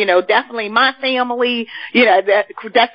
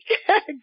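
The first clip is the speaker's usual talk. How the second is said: laughing shriek; 8 semitones higher, 5 dB quieter than usual. Real, not acted. Host laughing hard.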